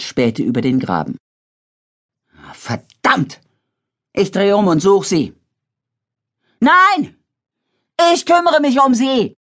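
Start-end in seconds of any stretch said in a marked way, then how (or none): 2.5-5.3 frustrated curse. A narrator's reading. David curses, wants to search. 6.6-9.4 high, loud firm refusal; shouting. A narrator's reading. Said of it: "brüllte Katie".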